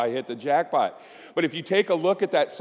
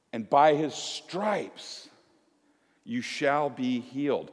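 slow, soft solemn reading; solemn scripture; 4 dB quieter, slower than usual. Retold or imitated. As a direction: read the verse slowly and gently. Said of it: "he says".

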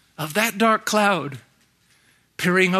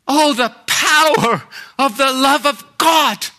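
awed exclamation; 5 semitones higher, 3 dB louder than usual. Real, not acted. Exclamation in sermon.

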